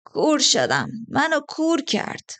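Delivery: high, drawn-out wail; acted wail of a hurt man. A narrator's reading. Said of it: "مرد روی زمین شیون کرد".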